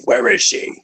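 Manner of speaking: gravelly whispered Batman growl. Pretend. Guy teasing friends, Batman whisper growl. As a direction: rasp it low like Batman. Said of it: "you do the Batman thing".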